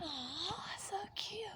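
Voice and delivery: soft high cooing whisper. Real, not acted. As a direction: coo softly, so cute. Seeing baby birds.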